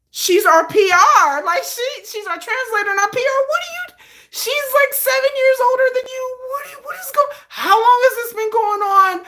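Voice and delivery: high, loud panicked rant; freaking out. Retold or imitated. Jin freaking out about Yoongi dating their PR, high panicked rant. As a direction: rant in high-pitched shock.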